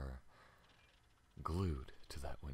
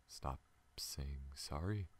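usual speaking voice

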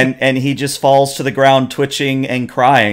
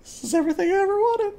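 laughing squeal; 4 dB quieter, much faster than usual. Real, not acted. GM overjoyed at player's line.

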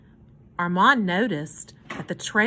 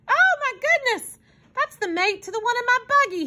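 high-pitched, thrilled exclamation; excited cry; 14 semitones higher than usual. A narrator's reading. Hobo spots his buggy's missing part, crying out excitedly. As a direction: burst out with delighted excitement. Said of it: "jumping up and down with excitement".

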